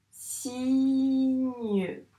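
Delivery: "Cygne" very drawn out, siii-gneu; slow sustained "Cygne" in two beats; syllable by syllable. Shown as a demonstration. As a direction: hold a long si in "Cygne", then add gneu. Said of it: "énoncer chaque syllabe".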